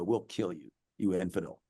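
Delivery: put-on low, quiet menacing threat from a girl; muttered threat. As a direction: growl the threat under your breath. Girls threatening the daughter.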